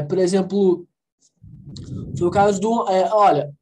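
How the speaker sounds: usual speaking voice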